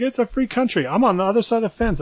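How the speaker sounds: mock indignant protest. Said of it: "I was like".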